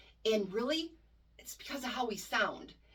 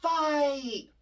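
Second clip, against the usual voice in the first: drawn-out word, louder and higher; the sluggish version of the call; slow, dragged-out fight; 6 semitones higher, 5 dB louder than usual. Shown as a demonstration. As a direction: let the word drag slowly. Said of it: "You're not gonna go".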